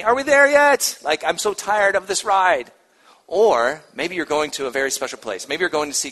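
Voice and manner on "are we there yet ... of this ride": put-on high, whiny tired kid; backseat whining. Shown as a demonstration. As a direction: whine it like a bored child in the car. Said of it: "It can be that whiny".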